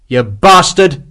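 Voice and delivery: very slow, loud, high screamed cry; desperate scream. Acted. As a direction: scream defiance at death. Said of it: "Hexwell screamed".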